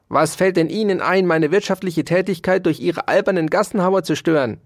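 outraged high-pitched scolding; indignant shout. A narrator's reading. Dauerspeck berates the mouse for disturbing his work, indignant. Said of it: "schrie er erbost".

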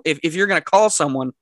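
ordinary talk, nothing marked

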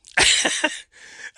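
loud breathless laugh; burst of laughter. Real, not acted. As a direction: let a surprised laugh burst out. Surprised by the listener count.

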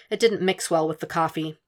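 usual speaking voice

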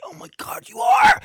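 high gasping cry of recognition; astonished shriek. Retold or imitated. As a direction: shriek it out, caught off guard. Meeting famous author unexpectedly.

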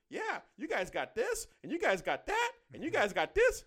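high-pitched mock marvelling, each phrase rising; awed excitement. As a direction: imitate wide-eyed locals listing wonders. Acted foreigners marvel at America.

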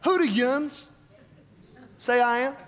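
Pennsylvania neighbor asking, yuns; Pennsylvania accent. Shown as a demonstration. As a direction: Pennsylvania accent, mild and casual. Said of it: "let me go Pennsylvania".

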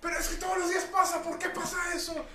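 high shrieking customer lady; mock angry lady. Retelling an upset lady caller, high mock shriek. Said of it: "una señora me dijo".

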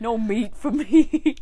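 loud, high, drawn-out silly declaration; hammed-up joking refusal; mock vegan voice. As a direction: stretch it out in a put-on voice. Joking vegan plot-twist mock voice.

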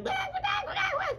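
very high, loud squawking; noisy hen squawks. Acted. Henny Penny joins the noisy alarm, squawking. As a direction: squawk up a racket. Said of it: "They made enough noise".

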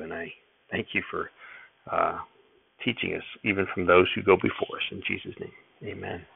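soft, earnest prayer voice; bow your head and pray quietly. Real, not acted. Reader's prayer before reading.

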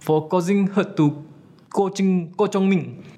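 usual speaking voice

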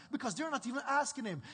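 ordinary delivery

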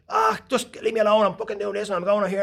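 high, strained angry outburst; shouting dad. Acted. Dad in the front to his boys, acted angry shouting. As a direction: roar back as a stressed-out dad. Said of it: "he's shouting and roaring".

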